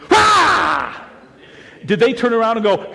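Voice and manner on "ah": high, loud sudden "Ah!"; startling shout. Retold or imitated. Sneaking up behind somebody, high loud startle shout. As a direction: jump out and scare them. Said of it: "sneak up and go".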